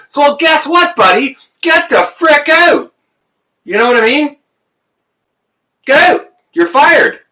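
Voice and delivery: high, loud shout; a loud, high yell of real anger; angry shout. Real, not acted. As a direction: fire them on the spot. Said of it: "for that rant".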